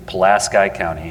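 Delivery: Pulaski ending in "sky", stressed on the middle; Indiana local pronunciation. Real, not acted. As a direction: say Pulaski as puh-LASS-kye. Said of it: "that's how they pronounce it in Indiana".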